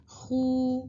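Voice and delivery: خو as a long firm "khoo"; tight rounded vowel. Shown as a demonstration. Teacher contrasting madd with leen, firm rounded vowel. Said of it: "سختی کے ساتھ ہم وہ ان کو پڑھتے ہیں".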